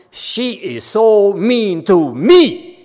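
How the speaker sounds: slow, high, loud mock grievance; aggrieved complaint. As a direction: stretch out each word like a wronged victim. Acting out self-justifying complaint.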